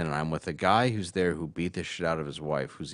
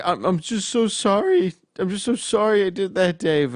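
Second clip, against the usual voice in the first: mock blubbering apology; 11 semitones higher, 5 dB louder than usual. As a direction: play the sobbing giant begging forgiveness. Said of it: "this enormous guy is crying".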